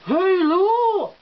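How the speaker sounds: "hello" hooted high, long and falling; posh hello demo. Shown as a demonstration. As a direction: stretch "hello" into a plummy "helloo". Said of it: "It kind of ruminates down there".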